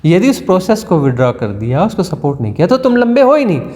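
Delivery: ordinary delivery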